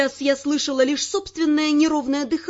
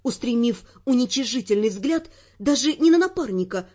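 normal talking voice

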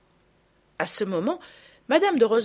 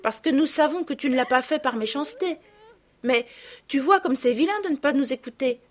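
higher, gently explaining; kind older sister; 7 semitones higher, 3 dB louder than usual. Acted. Camille kindly explains to her little sister why it was wrong, higher voice.

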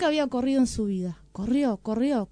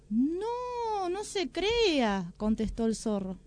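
sly fox; 7 semitones higher, slower than usual. Acted. Fox pretends the meat is bad.

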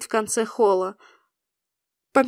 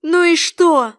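higher, louder retort; angry cry; 5 semitones higher, 6 dB louder, slower than usual. A narrator's reading. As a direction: snap back angrily. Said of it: "рассерженно вскричала я". (сердитый выкрик)

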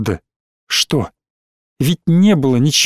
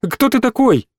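high call after someone; shout; 7 semitones higher than usual. A narrator's reading. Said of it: "крикнула ему в спину".

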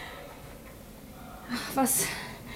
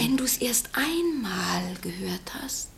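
slow, hushed, ominous whisper; slow whispered warning; 9 dB quieter, slower than usual. Acted. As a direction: draw the listener in slowly, whispering. Lucy warns of the gnawing.

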